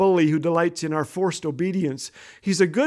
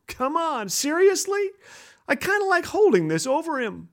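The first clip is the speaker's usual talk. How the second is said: high-pitched, incredulous objection; mock protest; 9 semitones higher than usual. Reluctant believer answering God, high mock protest. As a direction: balk at it in a raised pitch. Balking at the command to forgive.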